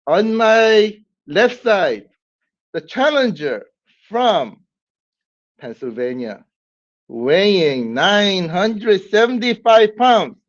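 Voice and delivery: high, slow, drawn-out boxing announcer build; ring announcer. Pretend. Host introducing the challenger to viewers, booming ring announcer call. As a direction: stretch each word like a fight-night MC.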